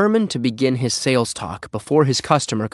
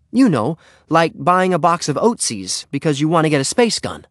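higher, lively character voice; explaining Henry; 6 semitones higher, 3 dB louder than usual. Acted. Henry gives his mom an example, higher and eager.

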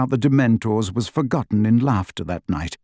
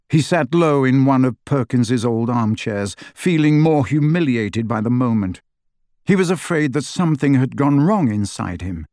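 usual speaking voice

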